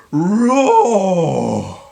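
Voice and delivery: long, loud roaring growl of a lion; big roar. Acted.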